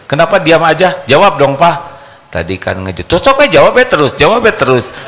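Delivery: high, loud nagging wife; wife berating her silent husband, loud and high; angry wife. Pretend. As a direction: demand he answer, badgering.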